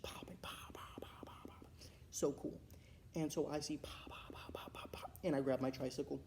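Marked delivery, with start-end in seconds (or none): 0.0-1.7 soft whispered pops, no voice; imitated fireworks. Wrestling fan mimicking firework displays, whispered popping. 3.8-5.1 whispered run of rapid pops; imitated sparks. Child watching sparks fly like fireworks, whispered popping.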